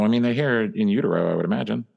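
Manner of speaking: ordinary delivery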